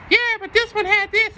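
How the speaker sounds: put-on high whiny kid voice arguing back; squeaky bratty comeback; mocking seventh grader. Seventh graders arguing with his class, high whiny kid voice.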